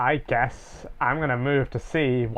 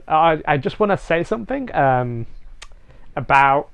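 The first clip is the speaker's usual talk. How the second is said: usual speaking voice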